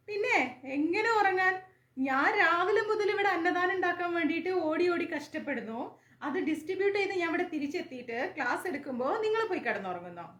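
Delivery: mock scolding. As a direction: scold them in jest, not really angry. Teacher mock-scolds sleepy students.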